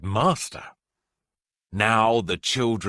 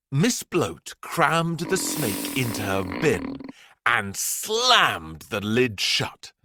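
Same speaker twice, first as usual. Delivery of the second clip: ordinary delivery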